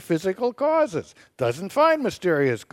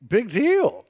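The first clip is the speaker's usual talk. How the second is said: dismissive sarcasm, pitched up; sarcastic; 5 semitones higher than usual. Real, not acted. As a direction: brush it off with mocking scorn. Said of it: "why are you being so sarcastic".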